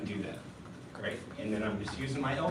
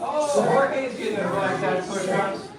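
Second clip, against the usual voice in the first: loud, higher, drawn-out cheer; called-out praise; 5 semitones higher, 7 dB louder than usual. Real, not acted. Coach cheers Jorge's push-ups over the count, calling out praise. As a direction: cheer a student on, slowly and loudly.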